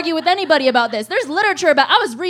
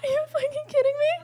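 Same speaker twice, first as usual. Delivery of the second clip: much higher, fast, laughing through it; laughing disbelief; 14 semitones higher, 5 dB quieter, faster than usual. Retold or imitated. Stunned to learn her girlfriend believes astrology, laughing. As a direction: blurt it out cracking up in disbelief.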